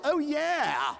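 ordinary talk

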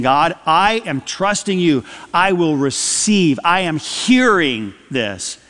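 normal talking voice

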